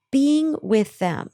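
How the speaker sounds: normal talking voice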